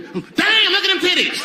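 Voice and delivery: crude catcall. Retold or imitated. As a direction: yell it like a tipsy bro at a bar. Drunk guy catcalling a woman.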